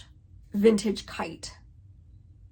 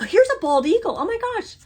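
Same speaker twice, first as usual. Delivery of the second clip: squealed much higher; a sudden thrilled gasp of a line; delighted surprise; 11 semitones higher, 6 dB louder, faster than usual. Real, not acted. To viewers on seeing the eagle chart, delighted squeal.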